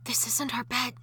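ordinary talk

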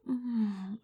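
normal talking voice

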